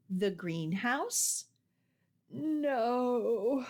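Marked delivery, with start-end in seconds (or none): 2.3-3.7 higher, sleepy yawning no; lightly voiced sleepy child yawning. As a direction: stretch the word out through a yawn.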